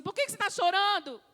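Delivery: high, quick parental scolding; exasperated parent. Acted. Parent questioning a child who won't stop crying, exasperated. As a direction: demand an answer like a fed-up parent. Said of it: "aí você fala pra ela assim".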